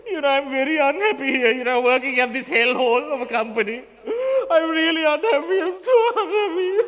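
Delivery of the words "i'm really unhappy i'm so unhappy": slow, sing-song fake crying; hammed-up wailing lament; mock sobbing. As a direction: whimper it out like a hammed-up sob.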